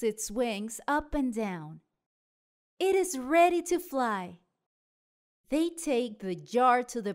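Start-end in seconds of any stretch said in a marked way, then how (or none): none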